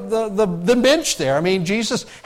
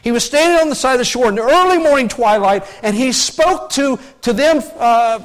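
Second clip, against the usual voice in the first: normal talking voice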